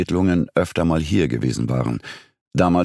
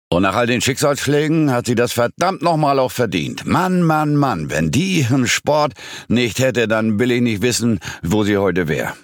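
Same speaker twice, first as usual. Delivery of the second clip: emphatic, higher-pitched pub regular; strong voice; 7 semitones higher than usual. A narrator's reading. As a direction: insist with a strong, raised voice. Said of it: "nicht weniger kräftiger Stimme". (kräftige, erhobene Stimme)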